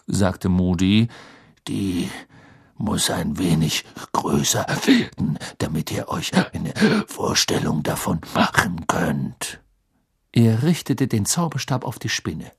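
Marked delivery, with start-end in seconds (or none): 1.7-9.6 low, growled lecturing voice; grim Auror teacher. A narrator's reading. Teacher explaining a curse demonstration to pupils, low grim growl. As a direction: growl it low, with halting breaths.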